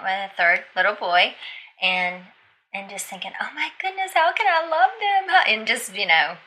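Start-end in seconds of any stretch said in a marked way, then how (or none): 3.4-5.4 high-pitched fretting thought; retold upset. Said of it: "upset about".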